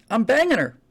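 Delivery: loud, high punchline burst; gleeful boast. Acted. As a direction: blurt it out as a triumphant brag. Joke punchline, doctor boasts to patient.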